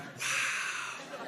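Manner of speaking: breathless, impressed whispered wow; half awed, half amused hush. Retold or imitated. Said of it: "He's like".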